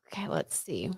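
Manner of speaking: soft whisper to herself. Real, not acted. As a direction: breathe it under your breath. Quiet aside while drawing a card.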